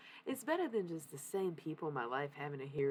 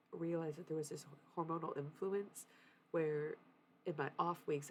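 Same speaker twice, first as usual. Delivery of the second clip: ordinary delivery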